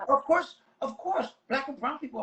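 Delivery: mock the shrug of a society that accepts it; sarcastic mock voice. Mocking society's casual acceptance.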